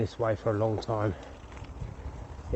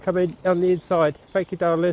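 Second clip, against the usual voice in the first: calling out; 6 semitones higher, 4 dB louder than usual. Real, not acted. Calling to walkers while passing.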